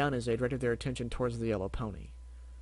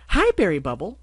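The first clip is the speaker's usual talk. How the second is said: loud, bright greeting with a rising end; cheering; 6 semitones higher, 10 dB louder than usual. Acted. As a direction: cheer his name out happily. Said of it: "she cheered".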